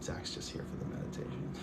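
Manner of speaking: soft unvoiced teasing aside to the chat; playful whisper. Real, not acted. Streamer teasing a viewer about the meditation, in a playful whisper. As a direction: whisper the tease as if sharing a secret.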